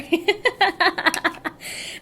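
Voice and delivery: bright burst of laughter. Real, not acted. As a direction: laugh at yourself. Laughing at herself.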